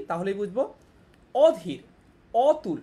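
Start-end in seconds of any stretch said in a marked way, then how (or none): 1.3-2.8 অধীর, অতুল with the অ said like ও, louder; o-like vowel. Shown as a demonstration. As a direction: say অধীর and অতুল with a closed ও sound. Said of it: "ও ধ্বনির মতো উচ্চারণ".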